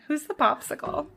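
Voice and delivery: a hint of Pietro's cocky greeting; mild Pietro impression; quoting Pietro. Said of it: "that is how Pietro addresses, uh, Vision".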